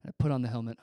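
usual speaking voice

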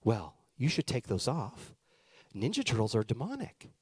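put-on grave disapproval; disapproving Sunday school teacher. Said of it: "Sunday school teacher looks at me and goes".

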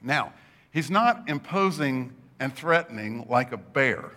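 ordinary talk

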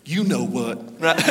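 low, soft, knowing parrot; sly parrot punchline. Acted. Parrot taunting the customer, sly and low.

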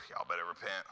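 low, soft sneering warning; smug religious leader. Retold or imitated. Proud teachers of the law condemning sinners, sneering. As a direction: look down your nose at them. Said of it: "I'm going".

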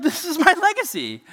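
a hammed-up wail of dismay; mock despair. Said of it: "And I'm like".

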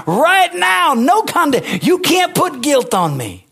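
starts slow, then climbs to a high, loud cry; building preaching shout. Real, not acted. Preacher shouting the verse at the congregation, rising to a high cry.

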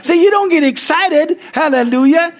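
high, loud full-force preaching; fired-up preaching. Real, not acted. Preacher urging the congregation to get excited, fired up. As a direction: drive it home at the top of the voice.

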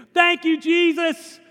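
put-on elderly church lady overjoyed at the groceries on her doorstep; overjoyed old lady. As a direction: gush your thanks like a delighted grandma. Old lady's triumphant reply.

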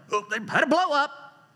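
high-pitched put-on aside; mock onlooker. Mock reaction to brief flare-up.

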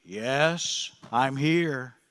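low, soft voice from above; voice of God. Retold or imitated. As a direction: answer calmly from heaven, deep and quiet. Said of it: "he hears a voice saying".

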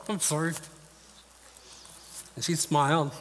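real emotion, voice breaking high with long pauses; fighting back tears while telling it; choked up. Real, not acted. Son recalling his dying mother's last words, choked up. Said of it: "I didn't think I'd get that emotional".

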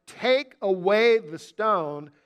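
very slow, deliberate command; measured, weighty proclamation. Retold or imitated. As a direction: stretch out each word of the order. Quoting Jesus' startling command.

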